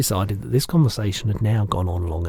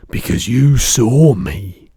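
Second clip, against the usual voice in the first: big, slow, booming giant voice; loud lumbering giant, higher and slow; the giant's voice; 4 semitones higher, 11 dB louder, slower than usual. Acted. Giant correcting the girl, big booming voice.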